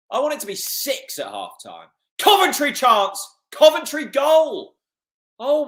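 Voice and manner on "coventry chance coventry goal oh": alarmed call. Real, not acted. Opponent scores live.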